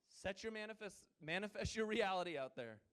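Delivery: ordinary talk, nothing marked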